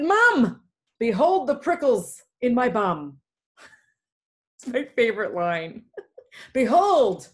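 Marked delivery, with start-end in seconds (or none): 0.0-3.2 high, loud call to mom, then easing off; shouted cry. Acted. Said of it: "I shouted". 6.5-7.3 loud, high mock-heroic cry; shouted proclamation. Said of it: "I shouted".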